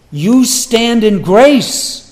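ordinary talk, nothing marked

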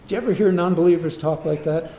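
ordinary talk

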